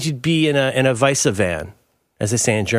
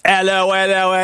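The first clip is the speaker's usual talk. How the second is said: loud-leaning, higher hammed-up British hello; bad British accent; 7 semitones higher, 4 dB louder than usual. Podcast host defends his British to co-host, hammed-up accent. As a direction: overdo a British accent, pitched up. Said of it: "That's your best British accent?".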